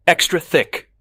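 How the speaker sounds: furious customer adds to his shouted order; loud demand. A narrator's reading. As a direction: snap the last demand.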